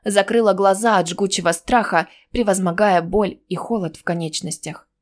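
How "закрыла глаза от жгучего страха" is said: ordinary delivery, nothing marked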